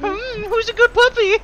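high sing-song puppy talk; talking to a dog. Pretend. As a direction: coo at him like a pet dog. Teasing a friend like a puppy.

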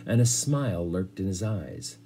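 ordinary delivery, nothing marked

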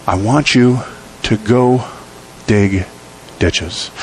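each word set apart with pauses, falling to dig ditches; slow emphatic command. Shown as a demonstration. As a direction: spell it out slowly, one word at a time. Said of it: "slow emphatic command".

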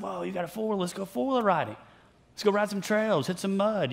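a mock-excited pal pitching a trail ride; eager buddy. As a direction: play the overeager friend begging to go. Pastor mimics eager friend passing by.

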